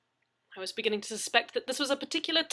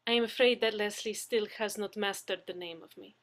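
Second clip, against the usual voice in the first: put-on Russian accent; 4 dB louder than usual. Russian character voice held.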